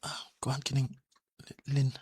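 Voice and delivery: whispered, hesitant confession; barely voiced stammer before confessing; nervous whisper. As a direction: breathe it out, too shy to say it aloud. Shy confession to Helen.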